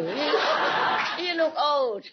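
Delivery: high, laughing teasing jab; mock scolding. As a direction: tease the husband through laughter. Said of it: "I'm just kidding".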